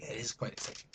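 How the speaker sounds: dry whisper; whispered aside. Acted. Telling viewers it's exciting in a whisper. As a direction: mutter it as a secret.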